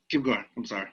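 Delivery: ordinary talk, nothing marked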